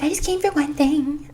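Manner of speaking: high-pitched put-on plea; mock pleading. Line-cutter holding a swimsuit pleads with shopper, mock pleading.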